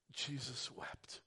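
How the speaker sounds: soft, slow hushed words; whispered. Real, not acted. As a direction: whisper the verse. Whispered quote of verse.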